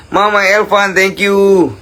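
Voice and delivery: bright high-pitched thank-you; high excited squeal. Real, not acted.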